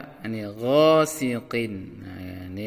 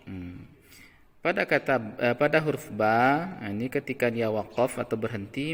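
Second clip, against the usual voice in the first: usual speaking voice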